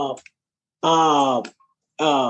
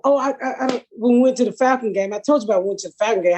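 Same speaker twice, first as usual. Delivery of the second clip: ordinary talk, nothing marked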